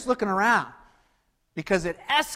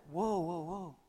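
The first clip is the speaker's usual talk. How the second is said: hushed whoa; 12 dB quieter than usual. Acted. Calming an imagined quarrel.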